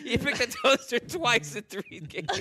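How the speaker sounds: high, loud, slow through laughter; incredulous, cracking up; laughing. Real, not acted. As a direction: barely get it out for laughing. Cracking up at repeated toaster answer.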